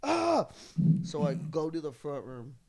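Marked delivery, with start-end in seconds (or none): 0.0-0.5 short, high, loud "ah"; exasperated cry. Retold or imitated. Housemate watching him scrub, sudden exasperated cry. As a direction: let out one sharp cry of frustration. Acting own exasperated reaction.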